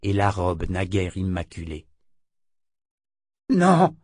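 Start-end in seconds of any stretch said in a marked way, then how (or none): 3.5-4.0 un «Non!» crié, plus aigu et plus fort; cri de refus désespéré. A narrator's reading. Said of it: "cria le sourcier". (crier «Non!» de toutes ses forces)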